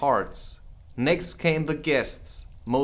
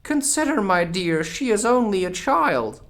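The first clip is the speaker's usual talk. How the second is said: much higher, loud nervous king voice; timid King; 9 semitones higher, 5 dB louder than usual. Acted. The King timidly soothes the furious Queen. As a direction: plead with your queen, timidly. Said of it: "timidly said".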